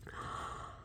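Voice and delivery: sudden breathy gasp of surprise; sharp gasp. Real, not acted. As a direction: catch your breath in shock. Shock at a card.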